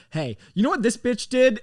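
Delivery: high, loud, indignant greeting; mock sister launching straight into a rant; retold heated outburst. Sister tattling to a newly arrived guest, heated.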